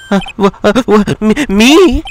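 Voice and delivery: startled stutter, rising high at the end; jittery, halting words climbing to a squeak; nervous stammerer. Acted. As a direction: stammer as if caught out. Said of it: "This guy is so nervous".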